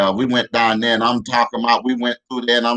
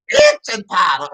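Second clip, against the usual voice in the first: diva high note; 5 semitones higher, 3 dB louder, slower than usual. Retold or imitated. Mister [name] squeals, shocked, high and loud. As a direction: hit the top note in shock. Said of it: "He hit a [name] note".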